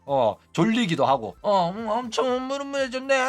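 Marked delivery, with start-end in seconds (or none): 1.4-3.2 put-on drunk slurring. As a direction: mimic a tipsy friend's tangled tongue. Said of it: "술 취해가지고 혀가 막 꼬인 거야".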